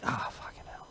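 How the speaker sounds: breathy, very soft whisper; hushed, resigned swearing; whispered curse. Retold or imitated. Quoted resigned reaction to message.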